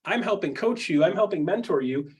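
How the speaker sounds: normal talking voice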